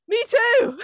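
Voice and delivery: high, loud squeal of delight at a shared fandom; excited squeal. Retold or imitated. Replaying her reaction to Sophie's anime news, squealing with delight. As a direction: burst out like a thrilled fan.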